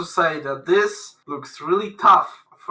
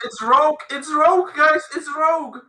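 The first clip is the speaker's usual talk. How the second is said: high excited yelling; excited shout; 9 semitones higher than usual. Real, not acted. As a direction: call it out thrilled. Excited reveal of group draw.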